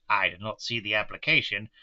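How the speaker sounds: plain reading voice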